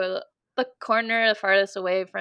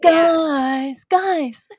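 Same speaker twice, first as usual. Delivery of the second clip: high, loud grand proclamation; dramatic fantasy voice; 7 semitones higher, 7 dB louder than usual. Pretend. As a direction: call out like an epic hero. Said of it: "watch out ... talking like that".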